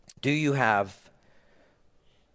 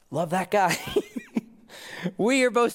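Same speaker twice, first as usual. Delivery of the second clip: laughing; 8 semitones higher than usual. Real, not acted. Laughing while telling marriage story.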